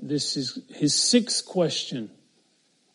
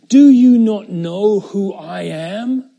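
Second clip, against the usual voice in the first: louder, slow, commanding; Pilate's authority; 4 semitones higher, 10 dB louder, slower than usual. Acted. Pilate reminds Jesus of his authority, loud and slow. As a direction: pull rank on the silent prisoner. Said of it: "So Pilate reminded him".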